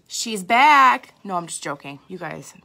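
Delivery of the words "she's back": excited. Acted. Jokey triumphant announcement.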